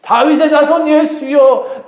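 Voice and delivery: high, stretched desperate shout. Acted. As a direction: cry out insistently to be heard. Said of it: "더 크게 소리 지릅니다". (더 크게 부르짖는 외침)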